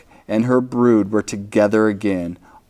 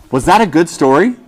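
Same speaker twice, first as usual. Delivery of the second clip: eager question; 6 semitones higher, faster than usual. Real, not acted. Host asking the children if they liked it, eager. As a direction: ask the class with excitement.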